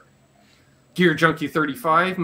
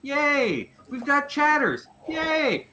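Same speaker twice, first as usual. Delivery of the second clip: bright, high, cheering welcomes; hype intro; 8 semitones higher, slower than usual. Real, not acted. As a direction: pump up each name like a showman. Hyping up the panel intro.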